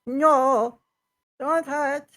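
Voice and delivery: high, loud panicked pleading; frightened kobold. Acted. Kobold begs the crew not to hurt it, frightened. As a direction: beg for mercy in a small creature's voice.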